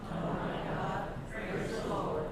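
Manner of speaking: hushed, distant voice of an amazed crowd. Acted. Preacher voicing the awed crowd, hushed and distant. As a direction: murmur it like a crowd far off, in awe. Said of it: "the crowd looked around in amazement and said".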